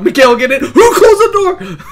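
laughing panic. Real, not acted. Laughing panic, door closed.

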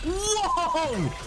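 high, yelping shouts broken by laughter; panicked laughing yells. Real, not acted. Gamer yelling at a monster while laughing in panic. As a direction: scream and laugh as the fight goes wrong.

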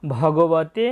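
very slow, drawn-out invocation; solemn recitation. Acted. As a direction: intone each word slowly with long vowels. Opens by reciting invocation and title.

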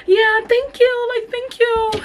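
very high, slow, strained "thank you"; mock sweet, very high and slow; awkward fake thanks. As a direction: squeeze out a polite thanks.

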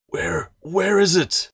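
high, slow, breathless gasp; gasped plea. A narrator's reading. Cowled man gasping at Claire in the storm, breathless. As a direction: gasp it out, straining to be heard. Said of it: "the man gasped without warning".

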